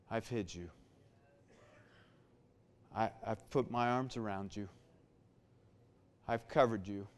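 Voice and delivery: very soft, low, soothing voice; God's tender reassurance. Acted. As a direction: comfort gently, pausing after each short promise. God comforting the discouraged believer.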